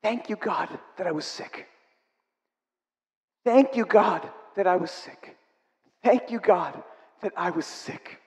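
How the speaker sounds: soft, hushed tearful gratitude; tearful thanks. Retold or imitated. Quoting Susie's tearful prayer on the phone, soft. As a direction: quietly echo her weeping thanks. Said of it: "I remember her saying".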